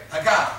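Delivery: "Hagah" with the second syllable punched loud; "Hagah", accent landing on the last syllable; stress on second syllable. Shown as a demonstration. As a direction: hit the end of "Hagah" hard. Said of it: "Accent on the second syllable".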